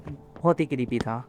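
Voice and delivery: low, slow, hushed spooky tone. Acted. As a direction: drop the voice as if telling a ghost story. Horror game, describing stalker monster. (धीमी, डरावनी फुसफुसाती आवाज़)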